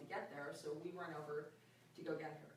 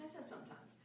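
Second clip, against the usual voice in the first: breathy whisper, pitched up; whispered aside; 9 semitones higher, 9 dB quieter than usual. Real, not acted. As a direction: slip it in under the breath as a tease. Teasing aside about volatile stepdaughter.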